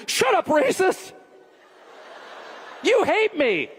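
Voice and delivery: high, drawn-out accusing protest; mock indignant kid. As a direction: play an outraged teen turning on a parent. Acts kid's angry comeback.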